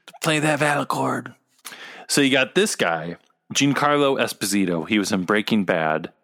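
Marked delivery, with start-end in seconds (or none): 0.1-1.3 Tobias Beckett. Retold or imitated. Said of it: "Tobias Beckett".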